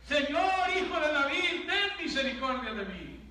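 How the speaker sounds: loud, high desperate cry for mercy; shouting plea. Acted. Blind beggar shouting to Jesus over the crowd, loud desperate cry. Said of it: "empezó a gritar".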